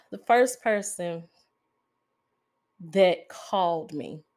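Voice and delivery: holding back tears. Real, not acted. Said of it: "I'm gonna try not to cry".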